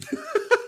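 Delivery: a sudden high laugh at the roast; high burst of laughter. Real, not acted. Host laughing at a video roasting a boxer, high burst of laughter.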